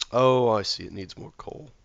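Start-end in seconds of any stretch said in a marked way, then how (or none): 0.1-0.8 a loud, startled "oh, I see"; sudden realization. Real, not acted. Sudden realization about the coal.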